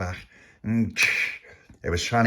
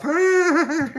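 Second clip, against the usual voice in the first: very high, loud squeal; acted squeal; 5 dB louder than usual. A narrator's reading. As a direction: squeal while rolling off. Baby rolls off.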